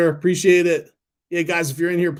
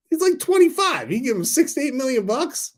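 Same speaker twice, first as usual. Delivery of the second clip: high, louder disbelief; incredulous; 13 semitones higher, 4 dB louder than usual. Real, not acted. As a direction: sell the outrage, pitched way up. Exasperated at the Trey payout.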